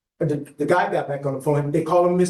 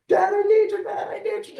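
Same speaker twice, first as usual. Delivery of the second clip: a much higher, pleading retold cry; imitating crying daughter. Father telling the judge his daughter's words, imitating her high pleading cry. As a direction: echo his hurt daughter's desperate plea, pitched way up. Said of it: "she's crying hysterically".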